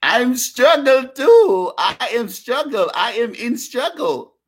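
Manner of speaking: mock Punjabi accent, pleading the struggle. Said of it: "they be telling you".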